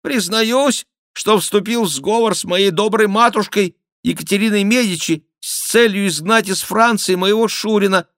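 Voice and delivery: high, loud nasal jester; jester's nasal mock confession; nasal whine. A narrator's reading. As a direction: whine it loudly through the nose. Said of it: "загнусавил шут".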